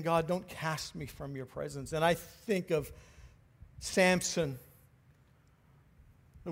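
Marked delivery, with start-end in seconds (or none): 0.0-1.9 soft, lower prayer to God; quietly voiced plea to God; hushed plea. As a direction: plead quietly, as in private prayer.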